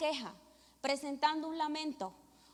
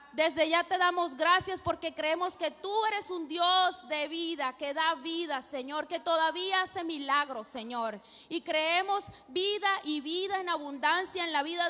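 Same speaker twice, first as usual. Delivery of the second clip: pleading prayer building louder and higher; fervent prayer; 3 semitones higher, 4 dB louder than usual. Real, not acted. Woman praying to God for a sick child's healing, fervent building prayer. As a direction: pray aloud with mounting force, phrase on phrase.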